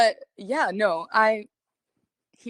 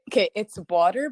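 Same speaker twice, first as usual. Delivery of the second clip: normal talking voice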